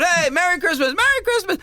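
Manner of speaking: high, singsong holiday hail; cheery greeting. Acted. Walker calling to the crowd along the parade, cheery. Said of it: "I know the way I was talking".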